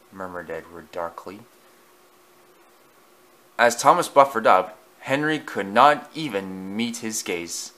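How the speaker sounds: ordinary delivery, nothing marked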